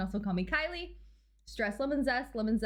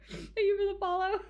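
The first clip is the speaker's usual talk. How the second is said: laughing; 13 semitones higher than usual. Real, not acted. Laughing thanks.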